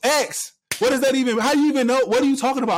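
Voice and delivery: high, loud mock mother scolding; hammed-up angry mom voice; imitated scolding mom.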